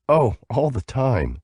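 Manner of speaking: slow and smiling. A narrator's reading. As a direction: let the smile be heard. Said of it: "a smile in her voice".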